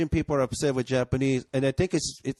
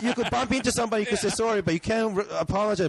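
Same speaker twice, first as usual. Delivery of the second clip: mock outraged high voice; 8 semitones higher than usual. Ranting about Japan's apologies, in a high mock-incredulous voice. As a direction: squeak it out in disbelief.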